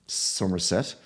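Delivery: Somerset said with a rolling Cornish R; Cornish accent. Retold or imitated. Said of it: "What was that accent?".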